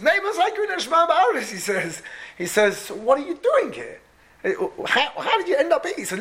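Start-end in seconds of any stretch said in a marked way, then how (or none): 0.0-1.5 eager recital. Retold or imitated. Reciting mishnah to prove himself. 2.8-6.0 amazed question. Retold or imitated. Officer asks in amazement.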